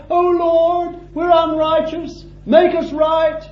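high, pleading wail of a penitent; mock plaintive cry. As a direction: imitate a whiny cry for mercy. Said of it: "a cry".

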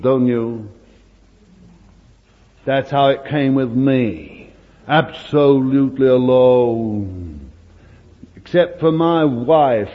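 ordinary talk, nothing marked

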